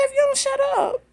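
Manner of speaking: high mock stern telling-off; retold scolding. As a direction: lightly replay snapping at her. Retold scolding the crying lady.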